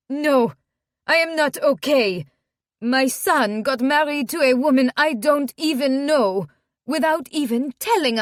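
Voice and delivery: put-on loud, high distraught French-accented protest; upset mother. Upset mother confronts new wife.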